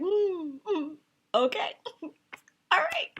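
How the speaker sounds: high, strained fake okay with laughter; panicked fake composure, high and laughing; forced calm. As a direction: pretend it's fine, voice squeezed up high. Forced calm while screaming inside.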